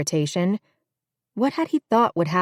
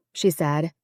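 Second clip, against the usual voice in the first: ordinary delivery, nothing marked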